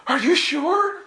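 high, plaintive mock whine; crushed disbelief. Pilgrim told he is not touching the footsteps, high plaintive question. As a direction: squeak it out, unwilling to believe. Acted dismay at friends' correction.

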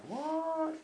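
high, soft puzzled "What?"; 1 baffled what. Retold or imitated. Confused reaction at hotel.

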